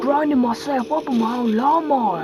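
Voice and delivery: high, loud hammed-up Australian bloke; mock Australian accent. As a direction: shout it like a cartoon Aussie mate. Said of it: "I'm Australian, mate".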